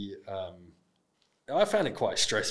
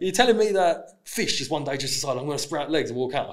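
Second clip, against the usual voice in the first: put-on Geordie accent; 5 semitones higher, 5 dB louder than usual. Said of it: "I can't do a Geordie accent".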